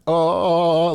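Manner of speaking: high, loud stoned wonder; retold blissed-out sigh of awe; awed. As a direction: marvel at the tree, high as a kite. Awed by a tree while tripping.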